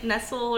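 Nestle first as "NESS-ul", then "NESS-lee"; alternative Nestle pronunciations. Shown as a demonstration. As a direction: offer two ways to say Nestle. Tries two pronunciations of brand.

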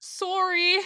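exaggerated Canadian-accented apology. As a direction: mimic the Canadian rounded O in sorry. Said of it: "they say it with their Canadian accents".

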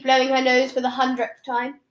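ordinary delivery

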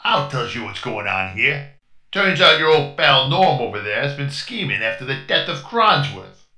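put-on light New York detective accent; tough-guy accusation; gangster accent. Detective accusing Norm to the crew, light New York accent. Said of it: "talking in a strange accent".

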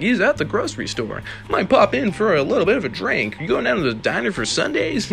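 high, bouncy small-town neighbour; wholesome fifties chat. Retold or imitated. Podcaster mocking fifties small-town gossip, high and bouncy. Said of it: "Why are we talking like this?".

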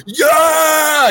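a huge high victorious yell of Yeah!; excited scream. Retold or imitated. Retelling the host's big win, a loud high yell. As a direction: scream it like you just won the race. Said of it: "He just screams".

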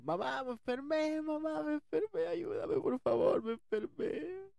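high, slow, drawn-out mock wail for help; stretched comic cry of terror; panicked screaming. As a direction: wail to mom as if dying, hammed up. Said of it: "y yo gritando".

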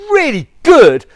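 loud, high, stretched-out brag; hammed-up self-congratulation; mock boasting. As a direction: boast it proudly, leaning hard on both words. Boasts to doctor about exercise.

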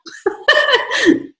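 high, delighted laugh; high laugh at a loving compliment. Real, not acted. Touched by viewers' loving comments.

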